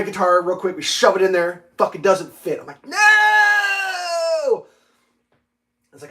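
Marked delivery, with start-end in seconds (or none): 2.9-4.6 high, loud cry of despair; anguished shout. Retold or imitated. When the guitar won't fit the case, a loud high wail. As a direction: wail it like the world ended.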